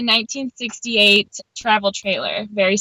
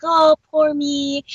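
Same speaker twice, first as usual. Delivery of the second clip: higher, drawn-out woe-is-me; playfully exaggerated poor-me voice; mock self-pity; 5 semitones higher, slower than usual. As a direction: put on a slow, pitying little lament. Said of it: "don't mean for this to sound really like".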